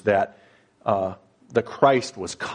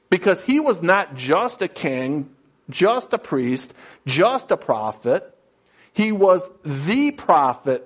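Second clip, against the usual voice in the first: higher-pitched, emphatic sermon build; impassioned preaching; 6 semitones higher than usual. Real, not acted. Preacher proclaiming the Messiah as the anointed one, impassioned. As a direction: preach it up high, leaning on each title.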